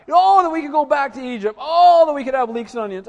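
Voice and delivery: high, loud hammed-up whine; mock whining. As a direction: ham up the grumbling crowd. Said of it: "they're murmuring".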